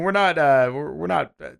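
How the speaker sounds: normal talking voice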